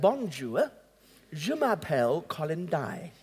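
put-on pastor greeting in a French accent. Pastor introduces himself to a French speaker, French accent. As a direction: lean into French vowels, lightly. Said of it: "I said in a French accent".

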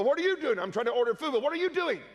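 high, startled back-and-forth; bouncing the surprise right back; surprised. Retold or imitated. Graduate startled to see his old principal, surprised. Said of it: "They go".

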